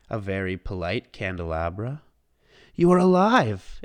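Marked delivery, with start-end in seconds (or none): 2.8-3.8 loud, high astonished cry; amazed exclamation. A narrator's reading. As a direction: gasp it out in wonder. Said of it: "exclaimed Maurice".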